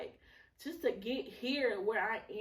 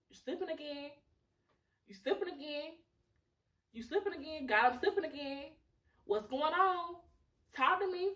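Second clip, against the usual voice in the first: higher-pitched mock self-confrontation; acted self-talk scolding; 5 semitones higher than usual. Talking to herself when she feels herself slipping, mock scolding. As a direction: call yourself out, pitched up.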